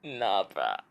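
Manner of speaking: low, flat nah bruh; deadpan dismissal. Retold or imitated. Vlogger answers the drama from the couch, low and deadpan. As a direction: drop it low and unimpressed. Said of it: "I'm just like".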